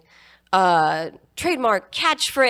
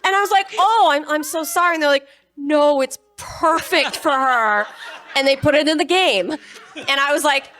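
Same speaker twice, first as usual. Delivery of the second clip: excited retelling; 8 semitones higher, 6 dB louder than usual. Retold or imitated. Excited retelling of studio mishap.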